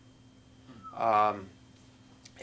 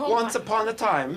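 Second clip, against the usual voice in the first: high, louder storybook British accent; hammed-up bad British accent; attempted British accent; 11 semitones higher, 5 dB louder than usual. Shown as a demonstration. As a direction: wobbly fake British, mistaken for Swedish. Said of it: "work on the British accent".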